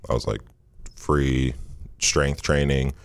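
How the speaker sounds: usual speaking voice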